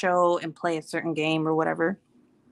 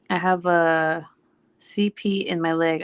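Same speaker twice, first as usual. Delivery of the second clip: normal talking voice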